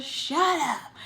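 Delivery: high, punched dismissal; retold indignant outburst; angry retort. Her retort to the neighbor.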